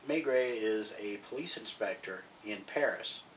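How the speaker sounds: ordinary talk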